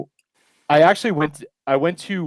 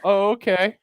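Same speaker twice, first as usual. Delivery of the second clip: higher mock realisation; retold sudden understanding; 7 semitones higher than usual. Visitor finally understanding the instructor, higher mock realisation. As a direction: act as if it finally clicks. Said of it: "I'm like".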